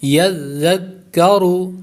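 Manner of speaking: یذکرو recited slowly, ya joined to zal and zal to the doubled kaf; tajweed recitation. Shown as a demonstration. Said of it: "یا کو زال کے ساتھ".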